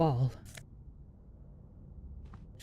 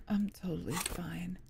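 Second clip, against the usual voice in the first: unvoiced whisper, much softer; 9 dB quieter than usual. Shown as a demonstration. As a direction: breathe it out in a whisper. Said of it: "I'm, I'm totally fine".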